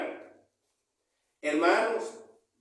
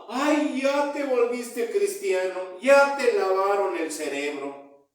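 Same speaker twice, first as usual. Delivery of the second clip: mock alarmed reaction; 4 semitones higher, 4 dB louder than usual. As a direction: imitate startled people reproaching a new convert. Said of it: "mucha gente se asusta y te dice".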